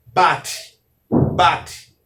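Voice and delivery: "bate" said alone, crisply, twice; Hebrew word demonstration. Shown as a demonstration. Teacher shows students the Hebrew expression, careful demonstration of "bate". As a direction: pronounce "bate" as a separate word, stressed on BA. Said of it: "como seria aqui a expressão".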